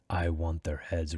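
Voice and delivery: understated and offhand; casual. A narrator's reading. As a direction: say it casually, low and unhurried. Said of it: "Rake replied casually".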